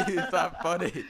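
laughing through the anger; slow, laughing protest; anger turning to laughter. Real, not acted. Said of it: "Okay, I".

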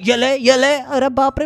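normal talking voice

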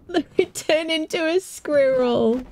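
high, giggly squeal; laughing delight. Real, not acted. Player amused at becoming a squirrel, high laughing squeal. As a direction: say it through giggles, then crack up.

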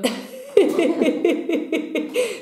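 high, drawn-out fit of giggles. Real, not acted. Tarot reader after going on too long, high giggle. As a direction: giggle helplessly at your own rambling.

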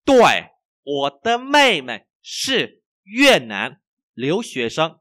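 very slow, loud, word by word; language teacher's model reading. Shown as a demonstration. Teacher reads for learners to repeat, slow model reading. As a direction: exaggerate each tone, high and clearly spaced.

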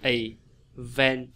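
"Event" split in two, weight on VENT; slow teaching model, i... VENT; second-syllable stress. Shown as a demonstration. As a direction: pause inside "Event" before VENT. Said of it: "Nó rơi cái âm nhấn vào âm thứ hai".

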